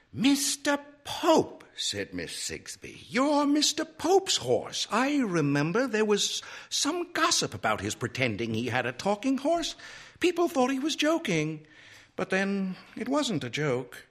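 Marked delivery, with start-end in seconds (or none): 0.1-1.6 high, slow dawning realisation; high-pitched wonder; astonished librarian. A narrator's reading. Librarian realises who horse is. 3.1-14.0 high-pitched lady librarian working it out; high, wondering librarian voice; astonished prim librarian. A narrator's reading. Librarian figure speaking.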